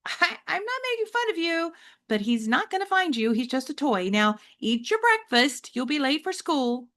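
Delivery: mom's voice. Acted. Mother telling James to eat breakfast, lightly voiced. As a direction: brush it off kindly.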